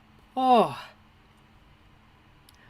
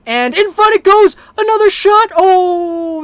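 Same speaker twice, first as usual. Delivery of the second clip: much higher and louder, near yelling; frantic goalmouth call; 12 semitones higher, 12 dB louder than usual. Real, not acted. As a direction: yell the last-second chance. Last-second chance in the game.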